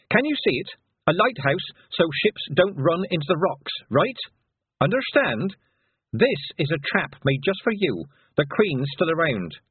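high-pitched, urgent explaining; panic. A narrator's reading. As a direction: press them to understand the danger. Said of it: "what Tiffany felt was real, honest panic".

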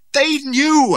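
high, loud, strained accusation; strained croak. A narrator's reading. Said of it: "I croaked".

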